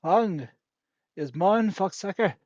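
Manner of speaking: loud, drawn-out fake Irish greeting; mock Irish phrase. Shown as a demonstration. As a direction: proudly declaim gibberish Irish. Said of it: "that's how you greet yourself in Irish".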